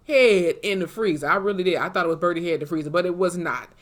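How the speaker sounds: ordinary talk, nothing marked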